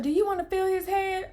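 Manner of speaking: high, light offering voice; perky high-pitched question; imitated medic's question. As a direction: imitate her asking, pitched well up. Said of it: "And she was like".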